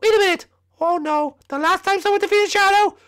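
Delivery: very high voice of dawning dread; high-pitched uh-oh voice; alarmed squeaky character. Acted. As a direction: worry in a tiny high voice. High figure realises danger.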